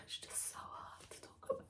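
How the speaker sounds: breathy sighing whisper, barely there; shaky whisper. Real, not acted. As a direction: let it slip out in a whisper, overcome. Sighing, overwhelmed by the memory.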